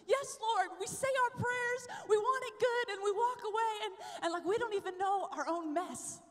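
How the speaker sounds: rising revival sermon; fiery preaching. Acted. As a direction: preach it out with urgency. Preaching at full force.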